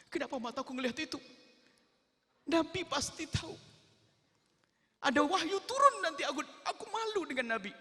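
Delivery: put-on high, loud, wailing voice of a frightened child; tearful boy. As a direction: cry out the shame of a scared boy. Boy fears the Prophet's anger.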